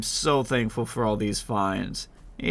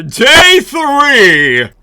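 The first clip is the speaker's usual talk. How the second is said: excited shout; 13 semitones higher, 15 dB louder than usual. Real, not acted. Day announcement, shouted intro.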